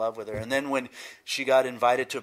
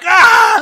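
loud, high scream of pain; full-volume acted yelp; 21 dB louder than usual. Boy bitten by a gopher snake, screaming. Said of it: "I'm screaming and yelling".

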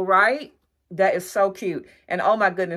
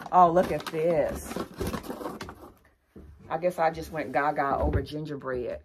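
ordinary delivery

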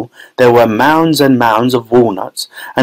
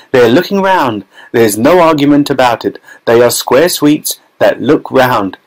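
ordinary delivery, nothing marked

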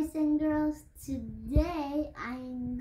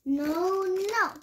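defiant shout; 6 semitones higher, 4 dB louder than usual. Acted. Child refuses again.

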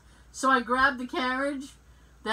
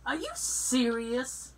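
incredulous; 3 semitones higher than usual. Retold or imitated. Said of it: "I went".

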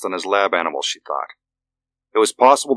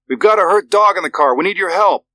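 high, loud urgent shout through glass; 11 semitones higher, 4 dB louder than usual. A narrator's reading. Father shouts to old vet through glass door, loud and urgent. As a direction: shout to be heard through the window. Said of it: "Richard shouted".

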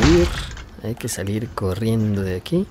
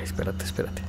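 hushed urgent whisper; 10 dB quieter than usual. Real, not acted. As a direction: breathe it out to hold him back. Le habla al personaje. (susurro urgente)

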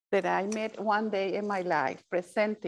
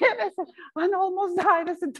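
laughing; 10 semitones higher, 4 dB louder, faster than usual. Real, not acted. Laughing while retelling.

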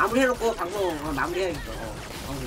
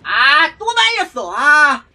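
loud, high cry of frustration; exasperated gamer outburst; frustrated shout; 4 semitones higher, 7 dB louder, much slower than usual. Real, not acted. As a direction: yell it out in real annoyance. Frustrated at being caught again.

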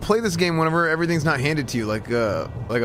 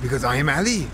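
put-on Ali, a Persian immigrant, in a heavy Persian accent; broken English with a thick Persian accent. Persian immigrant Ali talking to strangers in a Persian accent. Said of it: "That's Ali".

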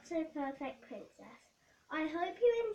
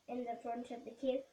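ordinary delivery

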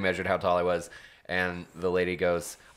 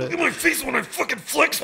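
straining while flexing. Real, not acted. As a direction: push it out mid-flex. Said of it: "visual comedy".

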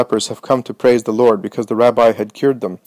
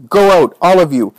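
higher, forceful angry order; commanding, raised-voice anger; wrathful command; 6 semitones higher, 4 dB louder than usual. A narrator's reading. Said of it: "imposing in her wrath".

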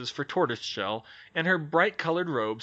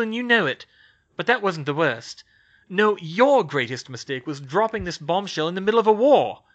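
louder, pressing case; forceful rebuttal; 5 semitones higher, 6 dB louder than usual. Acted. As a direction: hammer home the war argument. Skeeter arguing back.

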